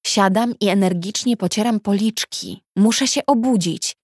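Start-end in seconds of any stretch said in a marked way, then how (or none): none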